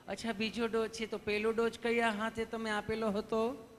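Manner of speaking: easy, affectionate nurse questioning; sweet nurse. Acted. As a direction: ask lovingly, like a nurse. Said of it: "nurse બેને સહજતાથી જેમ પૂછતા હતા".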